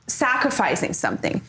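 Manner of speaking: usual speaking voice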